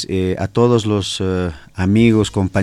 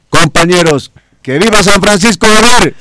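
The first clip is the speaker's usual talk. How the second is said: high, full-force rallying shout; more forceful shout; 11 semitones higher, 12 dB louder than usual. Real, not acted. Student leader urged to show more spirit, forceful shout. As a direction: shout the viva with all your might. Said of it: "un grito con más fuerza".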